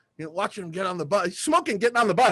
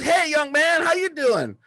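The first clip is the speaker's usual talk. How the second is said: high, cheerful hello with a big grin; friendly player greeting; 11 semitones higher, slower than usual. Retold or imitated. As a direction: greet the star-struck kid warmly. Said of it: "And he goes".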